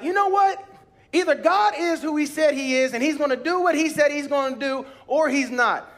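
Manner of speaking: high, loud declaration of faith; decide it out loud, fired up; fierce faith declaration; bold resolve. Retold or imitated. Deciding to trust God.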